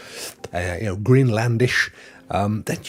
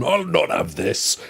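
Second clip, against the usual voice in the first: imitating Bjorn. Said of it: "he came straight back".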